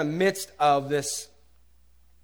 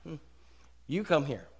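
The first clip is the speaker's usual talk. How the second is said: haughty beckoning; 3 semitones lower, 9 dB quieter than usual. Retold or imitated. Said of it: "So we tell God".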